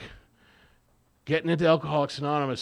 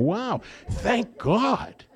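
slow amazed wow; 7 semitones higher, much slower than usual. Retold or imitated. Amazed at his own journaling.

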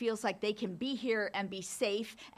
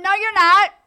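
much higher and louder contradiction; loud daughter snitching on her mother; calling her out; 11 semitones higher, 20 dB louder than usual. Retold or imitated. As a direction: blurt it out to catch her out. Contradicting mother in front of nurse.

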